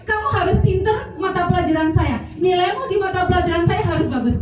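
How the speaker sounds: a hint of a teacher pressing her own subject; demanding teacher. Every teacher telling students, a touch demanding. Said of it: "Semua guru menginginkan".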